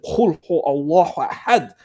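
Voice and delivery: Qul hu Allahu Ahad with Arabic sounds. Retold or imitated.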